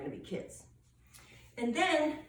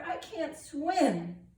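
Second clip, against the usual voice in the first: high, drawn-out storybook wail; acted yell; 7 semitones higher, much slower than usual. A narrator's reading. Tiger who cannot swim, slow acted cry. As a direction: stretch it out slowly in mild alarm. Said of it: "yelled the tiger".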